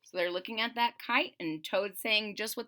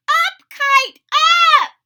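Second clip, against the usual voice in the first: high, much louder cheering shout; 17 dB louder, slower than usual. Acted. Toad shouting at his kite to fly, loud high shout. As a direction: yell it skyward with all your might. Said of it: "he shouted".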